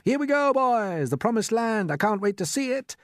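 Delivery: very high, loud, thrilled; company shouting as they set off; excited shouts. A narrator's reading. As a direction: cheer it out climbing the ladder. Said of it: "shouts of".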